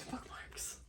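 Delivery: barely-there real whisper; overwhelmed whisper. Real, not acted. Overwhelmed by a friend's gift, whispering. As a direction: whisper it, too moved for voice.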